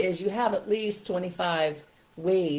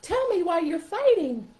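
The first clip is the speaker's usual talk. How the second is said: very high, slow puppet voice; high-pitched, drawn-out animal character; stuffed animal voice; 13 semitones higher, 6 dB louder, slower than usual. Shown as a demonstration. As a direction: talk as a concerned toy animal, pitched way up. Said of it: "You're changing your voice a little bit".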